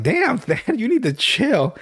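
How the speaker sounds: a touch of a high-pitched scold; lightly voiced exasperation; mock exasperated. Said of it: "I'm like".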